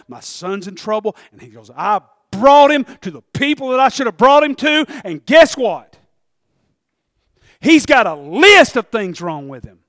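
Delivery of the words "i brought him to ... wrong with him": exasperated father. Acted. Father voiced complaining in sermon.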